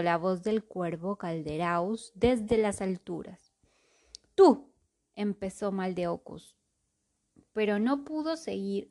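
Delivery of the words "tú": sharp outburst. Acted. Mage starts an insult.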